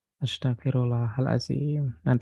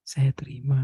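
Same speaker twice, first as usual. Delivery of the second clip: whisper. Acted. Prayer of acceptance in session.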